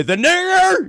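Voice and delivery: high mock rocker yell. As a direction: yell it as an impression, laughter close behind. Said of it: "That was not me yelling that word".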